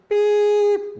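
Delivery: high, loud imitation of a tone; loud voiced beep. Shown as a demonstration. Using voice over a crying child, loud high beep. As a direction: sing out a piercing electronic beep. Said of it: "and I do it really loud".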